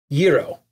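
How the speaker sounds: "gyro" said YEE-roh, with a Y sound; Greek-style gyro. Shown as a demonstration. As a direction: open "gyro" on a Y, not a J. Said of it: "people say it all different ways".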